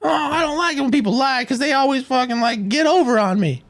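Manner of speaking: high-pitched mock complainer; mocking whiner. Host imitating people who can't lie, mocking whine. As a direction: put on a high voice to ridicule the complaint. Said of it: "people who don't know how to lie say".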